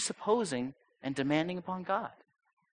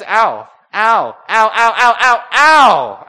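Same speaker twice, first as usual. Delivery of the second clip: rising, louder and louder cries of ow; loud pained ow; 6 semitones higher, 13 dB louder, much slower than usual. Retold or imitated. Pastor reliving the chiropractor probing his neck, yelping ow. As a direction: yelp ow each time he pushes harder. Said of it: "I literally said that out loud".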